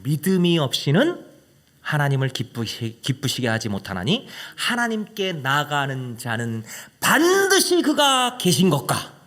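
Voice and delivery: slow, solemn recitation; scripture reading. Acted. Preacher reads Hebrews to the congregation, solemn. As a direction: read it out as holy words.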